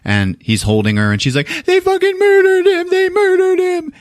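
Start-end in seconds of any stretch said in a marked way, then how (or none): 1.6-3.9 very high wailing scream; anguished acted shriek; retold hysterical mother. Retold mother, hysterical, being held.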